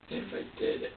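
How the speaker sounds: real tearful whisper; breaking down. Real, not acted. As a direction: barely get the words out through tears. Breaking down over hurting the baby.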